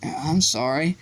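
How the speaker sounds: plain reading voice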